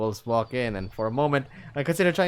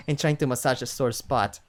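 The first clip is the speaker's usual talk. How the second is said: usual speaking voice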